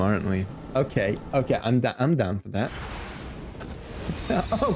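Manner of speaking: usual speaking voice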